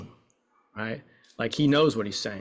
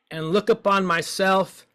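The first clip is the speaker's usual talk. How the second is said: slow, higher, louder despair; Job-like lament; 6 semitones higher, 4 dB louder, slower than usual. Shown as a demonstration. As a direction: bewail it like Job. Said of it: "let's curse the gods like Job".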